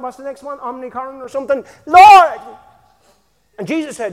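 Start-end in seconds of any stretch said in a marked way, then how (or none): 1.9-2.4 loud high cry of Lord; hammed-up desperate yell; mock wail.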